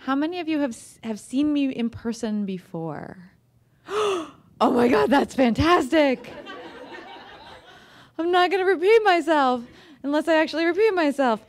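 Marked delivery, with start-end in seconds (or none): 3.9-6.3 gasp, then a bright high oh my God; delighted gasp. Real, not acted. Thrilled author reacting to fans who've seen her. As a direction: caught off guard with real delight.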